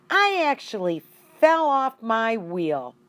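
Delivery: excited hamster gasp; surprised exclaim. A narrator's reading. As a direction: blurt it out in shock.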